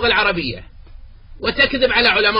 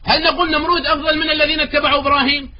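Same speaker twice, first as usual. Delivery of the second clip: normal talking voice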